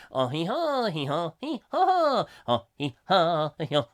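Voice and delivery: siren hee-haw sung out; mock siren hee-haw. Host imitating a French police siren.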